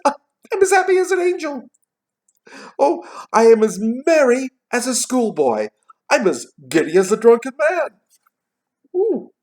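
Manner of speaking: very high, loud, whooping glee; giddy excitement. A narrator's reading. Scrooge reborn on Christmas morning, giddily excited. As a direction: let the voice soar, giddy and loud. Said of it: "He's getting a little excited".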